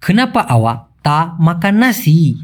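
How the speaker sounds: very slow, each word separate; Malay sentence said very slowly; slow Malay demo. Shown as a demonstration. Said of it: "teaching Malay sentence slowly".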